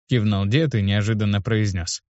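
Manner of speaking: usual speaking voice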